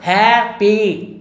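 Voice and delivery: Happy stretched into two beats, high; syllable by syllable. Shown as a demonstration. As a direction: sound out happy slowly, HAP, PEE. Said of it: "दो बार हो रहा है ना".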